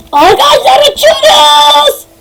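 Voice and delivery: drawn-out, very high cry of flying; long soaring yell; high-pitched shout. Pretend. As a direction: scream it as you're launched into the air. Cries out on being launched flying.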